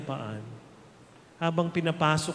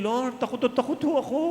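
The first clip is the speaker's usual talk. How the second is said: fearful prayer; 7 semitones higher, faster than usual. Pretend. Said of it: "and tell Him".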